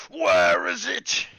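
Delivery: slow, booming, reverberating roar; loud, slow, unearthly wizard bellow; otherworldly rage. Acted. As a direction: let it rumble out like a possessed spirit. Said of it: "otherworldly reverberation".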